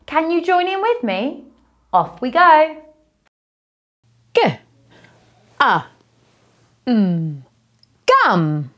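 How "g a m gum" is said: G, A, M sounded out one by one, then blended into gum; sounding out words. Shown as a demonstration. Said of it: "I'm going to sound out each word and then blend it together".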